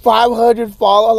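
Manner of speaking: ordinary talk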